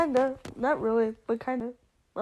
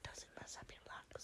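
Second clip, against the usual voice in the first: hushed unvoiced whisper; 24 dB quieter than usual. Real, not acted. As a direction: keep it to a whisper. Whispered aside.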